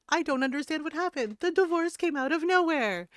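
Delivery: quick, high mock whine of a baffled husband; clueless husband. Streamer mocks a husband who claims he's blindsided. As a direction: whine like he never saw it coming. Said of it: "again, it's giving".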